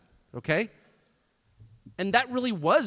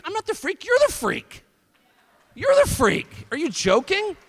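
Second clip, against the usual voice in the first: defiant shout; 12 semitones higher, 8 dB louder than usual. Acted. Defiant retort, rising anger.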